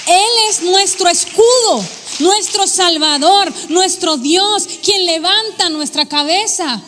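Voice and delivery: loud, proclaiming God's titles; loud declaration. Acted. Preacher proclaiming to the congregation, loud and fervent. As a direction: ring out each title.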